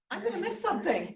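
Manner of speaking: high, giggly inner voice of panic; laughing mock thought. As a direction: squeal it like a silly little alarm in your head. Acted inner thought, brain's reaction.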